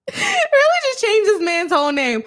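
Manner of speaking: high, loud, laughing. Real, not acted. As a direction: burst out laughing as you talk. Cracking up over green grass.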